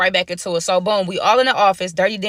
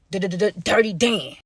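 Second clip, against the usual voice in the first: dramatic reveal; 2 semitones lower, slower than usual. Pretend.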